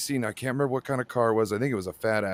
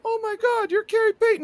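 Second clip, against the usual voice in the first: put-on very high, awed little boy voice; starstruck kid; 4 dB louder than usual. Kid recognizing Gary Payton, very high and awed. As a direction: gasp like a kid meeting his hero. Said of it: "he's like".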